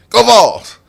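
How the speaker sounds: high, loud sudden Go Vols; out of nowhere shout. Real, not acted. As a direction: yell it at a stranger in orange. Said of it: "I say".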